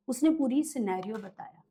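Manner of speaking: usual speaking voice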